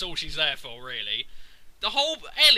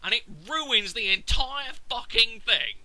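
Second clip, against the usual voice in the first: ordinary talk, nothing marked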